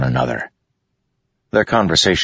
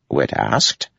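usual speaking voice

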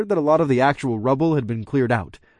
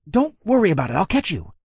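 reassuring; 7 semitones higher than usual. Acted. Reassuring her down the hole.

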